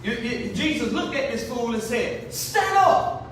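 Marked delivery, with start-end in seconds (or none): 2.3-3.2 high, forceful command to stand; commanding shout. Acted. Jesus ordering the sick man up, high commanding call. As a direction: order him up with authority. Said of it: "he said it with some authority".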